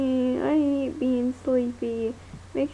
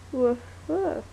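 ordinary talk

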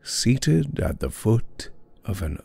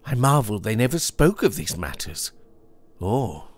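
put-on higher-pitched young goodman, puzzled; lightly voiced young man, troubled; doubtful young Puritan; 4 semitones higher than usual. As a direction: wonder aloud, pitched up.

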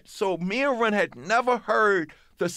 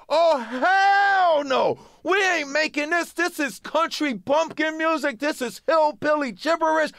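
high, loud indignant outburst, first words stretched; outraged protest; 9 semitones higher, 4 dB louder than usual. Retold or imitated. Young rapper refusing the song on the phone, outraged holler.

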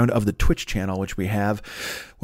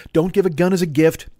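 ordinary talk, nothing marked

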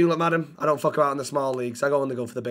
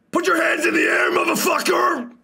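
high, loud yell as an American cop; 13 semitones higher, 4 dB louder than usual. Shown as a demonstration. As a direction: scream the order like an American cop. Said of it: "That's like an American cop".

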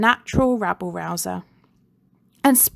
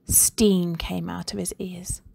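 ordinary delivery

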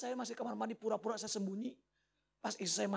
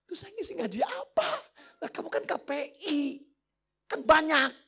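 flustered exchange; 6 semitones higher, 5 dB louder than usual. Retold or imitated. Acted exchange over last-minute preaching.

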